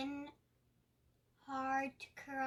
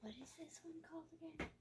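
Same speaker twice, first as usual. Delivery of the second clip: whispering; 3 semitones higher, 11 dB quieter, much faster than usual. Real, not acted. Quiet question aside during demo.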